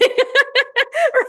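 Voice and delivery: loud, high giggling laugh; high burst of laughter. Real, not acted. Coach laughing at her own fake jab at prospects, real laugh. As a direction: crack up at your own joke.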